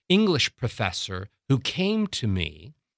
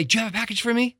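higher-pitched hopeful question; eager asking; 8 semitones higher, much faster than usual. Acted. Customer running to the delivery driver, eager asking. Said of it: "And I run down the steps and I say".

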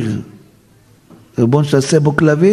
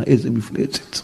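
ordinary talk

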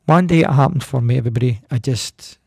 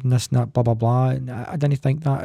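usual speaking voice

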